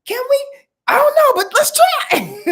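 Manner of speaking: high, loud cheer, laughing by the end; pumped-up cheerleader egging you on; cheerleader hype. Retold or imitated. Aries cheering a friend on, high loud excited cheer. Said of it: "They're like".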